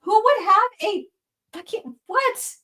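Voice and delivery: incredulous. Real, not acted. Disbelief at fetish idea.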